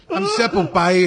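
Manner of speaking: put-on Puerto Rican accent. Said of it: "It was like".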